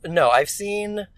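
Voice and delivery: ordinary talk, nothing marked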